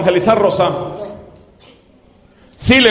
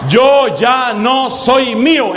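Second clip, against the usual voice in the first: slow, clear; 4 semitones higher, much slower than usual. Shown as a demonstration. Preacher prompting Rosa for radio listeners, slow clear phrase. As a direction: say it slowly so all can follow. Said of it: "repita usted más despacio".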